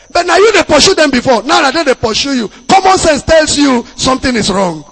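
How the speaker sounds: forceful preaching. Acted. Pastor pressing the congregation about dreams, forceful. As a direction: drive the point home.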